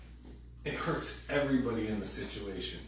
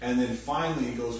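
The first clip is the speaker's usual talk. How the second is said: ordinary talk, nothing marked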